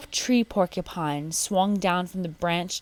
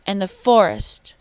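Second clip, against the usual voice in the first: higher, louder monkey chatter; excited monkeys warning the man; 4 semitones higher, 7 dB louder than usual. A narrator's reading. Monkeys chattering down at the sleeping man, higher and louder. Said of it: "They chattered to the sleeping man".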